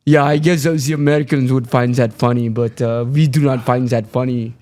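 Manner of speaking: put-on unamused, low German accent; deadpan reply in a mock German accent. German girlfriend rejects the comedian's joke, low deadpan German accent.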